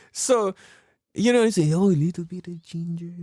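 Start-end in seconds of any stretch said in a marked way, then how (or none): 1.7-3.1 put-on Filipino-accented uncle, giggly; badly faked Filipino accent; attempted Filipino accent. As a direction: share the secret in a shaky Filipino accent. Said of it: "can't I not do Filipino accent".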